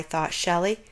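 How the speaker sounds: plain reading voice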